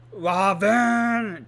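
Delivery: loud, high mock scream of a name; retold scream. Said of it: "just, like, screaming".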